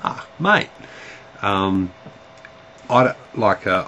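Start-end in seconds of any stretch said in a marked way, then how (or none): 0.0-0.7 put-on high, rising "mate" like spotting an old friend; mock cheerful "Ah, mate"; delighted greeting. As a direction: lift "mate" up high in happy surprise. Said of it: "Look, be, um".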